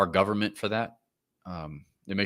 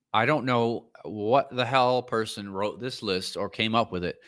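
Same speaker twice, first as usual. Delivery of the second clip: usual speaking voice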